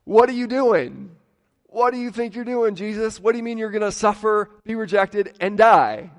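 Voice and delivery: a disciple pleading angrily with his teacher not to die; alarmed rebuke. Retold or imitated. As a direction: grab him by the collar with your voice. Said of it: "Peter grabbing Jesus by the collar and going".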